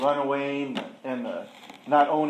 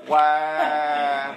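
mock wail; 5 dB louder than usual. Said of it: "then I'm like".

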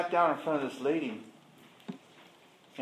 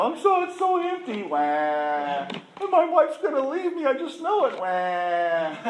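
mock whiny self; 9 semitones higher, slower than usual. To the counselor, mocking his own self-pity in a high whine. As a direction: ham up a self-pitying whine. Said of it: "then I'm like".

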